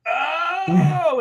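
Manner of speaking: high startled yelp; mock startled cry. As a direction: yelp like you just hit something. Caller hits something on road.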